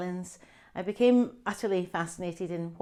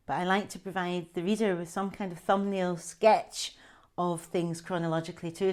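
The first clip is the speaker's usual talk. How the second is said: usual speaking voice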